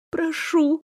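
high, soft begging; pleading whimper. A narrator's reading. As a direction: beg quietly. Caroline begs.

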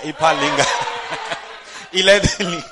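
loud words breaking into laughter; real laughter. Real, not acted. Preacher joking to congregation about a Lingala speaker, laughing. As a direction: laugh through the punchline.

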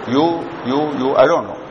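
ordinary delivery

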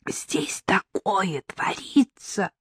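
slow urgent whisper; whispering on the phone. A narrator's reading. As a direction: whisper it slowly, afraid of being overheard. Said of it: "зашептала в трубку Лена".

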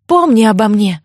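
usual speaking voice

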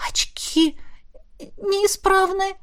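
whispered, slow dawning horror; a light, stunned hiccuping whisper; horrified whisper. A narrator's reading. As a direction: barely breathe it out. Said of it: "икнула".

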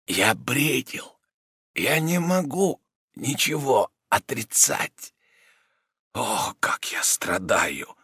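slowly, fading into a whisper of pain; weak suffering man. A narrator's reading. Rodin voice.

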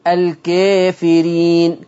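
الكافرين slow with the alif inclined; drawn-out الكافرين, alif leaning toward e; taqlil recitation. Shown as a demonstration. Teacher reciting the example slowly, with taqlil. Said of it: "آه،".

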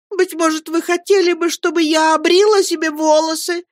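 high, hurt, weepy protest; tearful mother. A narrator's reading. Mother tearfully scolds Peggotty, high voice. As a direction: sob out the complaint. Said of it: "пуще прежнего заливаясь слезами".

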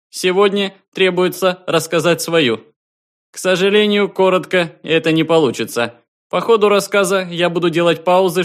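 high, loud official announcement; a distant station calling out formally; formal observer voice. A narrator's reading. Observer begins his story.

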